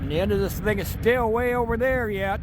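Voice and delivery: speaking up over the rumble of a train. Real, not acted. Filming a loud passing train.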